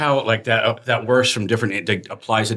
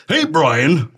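louder mock phone greeting; 5 semitones higher, 4 dB louder than usual. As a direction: answer like dad on the phone. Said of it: "You have a phone voice".

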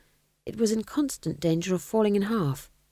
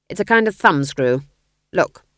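louder, eager explanation; ghost king explaining; 8 dB louder than usual. A narrator's reading. Ghost king explaining the thumbscrew to Nanny, loud and keen. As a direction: proudly show off the torture tool. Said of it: "said the king".

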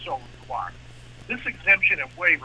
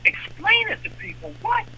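much higher, disbelieving outburst; incredulous. Real, not acted. Speaker scoffing at leaders who'll explain it to people, in shocked disbelief. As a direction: throw the words back in shocked disbelief.

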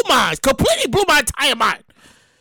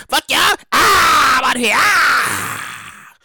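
walk out on the job yelling; mock retiree yell; much slower than usual. Retiree quitting, drawn out.

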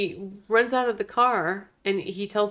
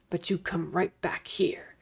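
douchey whisper; 6 dB quieter, slower than usual. Retold or imitated. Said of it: "But he's so douchey".

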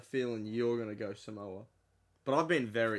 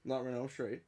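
ordinary delivery

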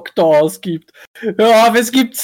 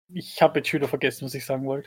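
fading away, much softer and lower; trailing off; 8 semitones lower, 10 dB quieter, faster than usual. Real, not acted. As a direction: let it peter out as you forget. Forgets what she wanted to say.

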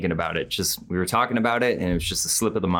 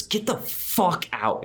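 stretched, pitched-up imitation of screaming; retold scream; 11 semitones higher, much slower than usual. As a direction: imitate a scream at reduced volume. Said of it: "And he just screams it".

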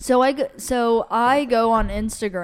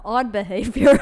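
dry verdict breaking into a laugh; laughing deadpan; 6 dB louder than usual. Retold or imitated. Quoting her reply to the guy, laughing through it. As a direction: deliver the burn and crack up. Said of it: "all I said was just".

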